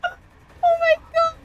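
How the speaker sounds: high, slow squeal through tears of laughter; crying laughing. Real, not acted. Streamer laughing to tears at her own blunder, squealing. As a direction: gasp it out while laughing hard. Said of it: "I'm literally crying".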